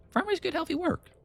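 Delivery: very high-pitched simple-minded retort; high character voice. Acted. Companion defending farming, voiced very high.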